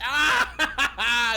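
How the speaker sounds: sudden loud burst of laughter; loud laughing shout. Real, not acted.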